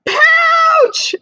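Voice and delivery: teacher voicing two scared kangaroos; frightened shout. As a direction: shout it as both joeys bolt. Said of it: "said the two kangaroos".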